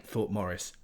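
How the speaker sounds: ordinary delivery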